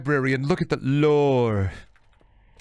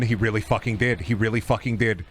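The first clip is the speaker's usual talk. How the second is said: normal talking voice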